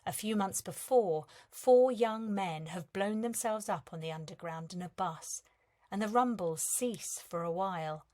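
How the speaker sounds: plain reading voice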